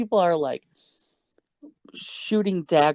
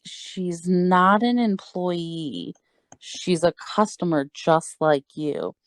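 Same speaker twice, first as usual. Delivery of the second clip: slow, drawn-out explanation; speaking slowly; slower than usual. Retold or imitated. Manager explaining to confused customers, slowly and deliberately. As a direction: spell it out slowly, hoping they understand. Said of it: "he speaks slowly, hoping they would understand".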